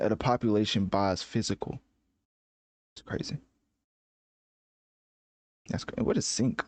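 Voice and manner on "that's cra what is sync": ordinary delivery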